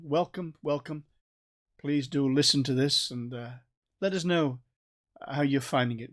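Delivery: normal talking voice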